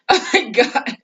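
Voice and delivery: high, laughing exclamation; real laughing. Real, not acted. Speaker tickled by the sarcastic daughter, laughing. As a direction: crack up on the words. Cracks up at the teen's retort.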